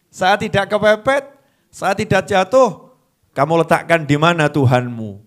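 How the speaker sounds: normal talking voice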